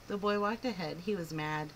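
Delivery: ordinary delivery, nothing marked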